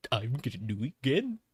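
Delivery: goofy gif voice. Retold or imitated. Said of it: "the funny goofy gif".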